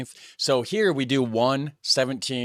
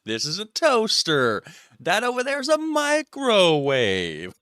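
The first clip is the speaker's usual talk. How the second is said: slow, higher teasing imitation; mock patronizing tour; 6 semitones higher than usual. Viewer mocking the fridge lesson, slow and higher. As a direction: parody her show-and-tell.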